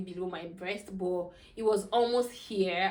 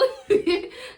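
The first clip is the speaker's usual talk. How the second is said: breathy laugh; 12 semitones higher, 8 dB louder than usual. Real, not acted. Laughing about the first picture.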